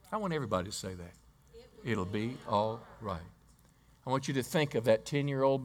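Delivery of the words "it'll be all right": calm reassurance. Acted. Mother's faith amid dead son.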